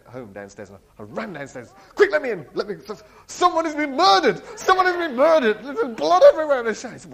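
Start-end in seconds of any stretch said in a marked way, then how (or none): 1.9-6.9 high, loud, breathless alarm; panicked. Retold or imitated. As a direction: burst in shouting about a murder. Panicked report of a murder.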